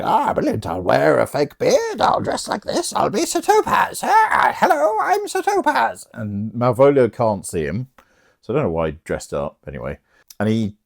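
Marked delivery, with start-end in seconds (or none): 0.0-6.1 high, silly disguise voice; hammed-up high-pitched impostor; Fester playing Sir Topaz. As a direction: climb into a squeaky fake priest voice. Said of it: "Fester's like".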